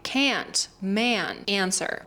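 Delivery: "can't, man, answer" with nasal, open, flat A's; nasal American A. Shown as a demonstration. Teacher giving examples, nasal American A in "can't, man, answer". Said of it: "pronounce more nasally and also more open".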